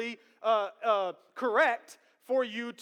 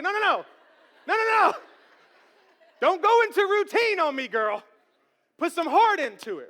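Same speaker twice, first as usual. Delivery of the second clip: higher and louder, mock-stern dad pushing back; playful correction; 8 semitones higher, 9 dB louder than usual. Dad telling daughter to put heart into it, loud mock-stern. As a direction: call her out with energy.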